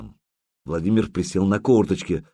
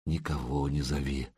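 a hushed whisper, much softer; 6 semitones lower, 10 dB quieter than usual. A narrator's reading. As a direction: breathe it into his hair. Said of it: "прошептала она в пушистую макушку".

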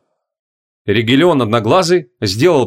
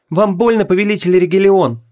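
high worried pleading; anxious young witch; frightened tearful girl; 6 semitones higher than usual. A narrator's reading. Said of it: "испуганно прошептала молодая колдунья".

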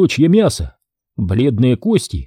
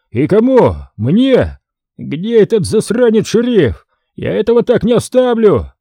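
put-on drunk ranting; 8 semitones higher than usual. A narrator's reading. Drunk Herman rants after threat.

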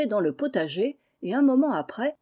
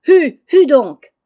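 long, loud, high calls to a horse; carter's shout; 7 semitones higher, 16 dB louder, slower than usual. Acted. Laurent urging on the cart horse, shouting. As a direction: drive the horse on with drawn-out calls.

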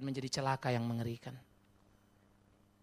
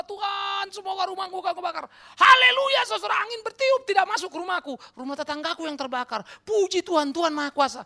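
high, shouted hallelujah parody; hammed-up triumphant believer; mock jubilant testimony; 11 dB louder than usual. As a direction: cry out praise at full voice.

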